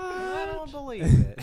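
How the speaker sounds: high, loud mock shock; mock disbelief. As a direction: squeal out the disbelief. Mock outrage.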